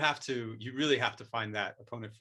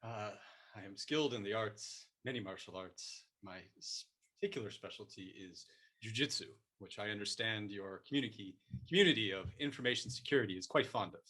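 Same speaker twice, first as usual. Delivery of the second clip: soft, lower-pitched sensei; martial arts Master, solemn; 3 semitones lower, 7 dB quieter than usual. Pretend. Master telling the host his specialty, quiet mock solemnity. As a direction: speak as a hushed, modest master of the arts.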